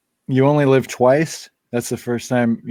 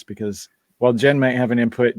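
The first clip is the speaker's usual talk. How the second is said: ordinary talk, nothing marked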